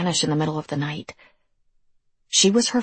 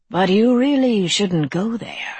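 higher, slow, lilting creature voice; mock-warning creature; 4 semitones higher, 3 dB louder than usual. A narrator's reading. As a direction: stretch out a teasing warning. Creature warns girl.

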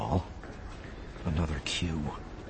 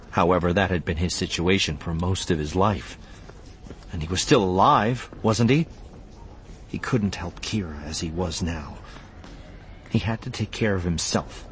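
usual speaking voice

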